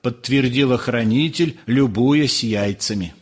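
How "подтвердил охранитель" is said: plain reading voice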